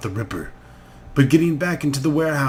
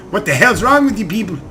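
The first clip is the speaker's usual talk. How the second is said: angry shouting; 9 semitones higher, 7 dB louder than usual. Acted. Addict yells at rescuers.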